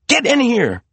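high, urgent command; urgent hiss. A narrator's reading. Maria to her father at back door, urgent hiss. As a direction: hurry him inside. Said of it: "she hissed at her father".

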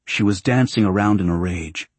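usual speaking voice

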